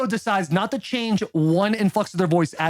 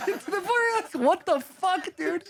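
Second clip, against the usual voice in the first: ordinary delivery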